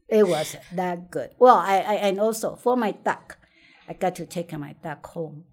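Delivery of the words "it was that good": laughing through "It was that good". Real, not acted. Laughing to the interviewer about how good the drumstick was. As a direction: let the laugh spill into the words.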